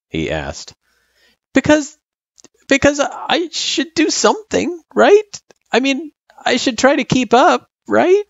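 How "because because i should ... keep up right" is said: put-on high, insistent child voice. A narrator's reading. Girl arguing she should do schoolwork, insistent. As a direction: insist haltingly, seeking agreement. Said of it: "she insisted".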